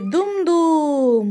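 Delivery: slow heavy dum dum; elephant steps. Shown as a demonstration. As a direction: stomp it out slowly like an elephant. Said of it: "হাতি কেমন করে চলে?". (ধীরে হাতির পায়ের শব্দ)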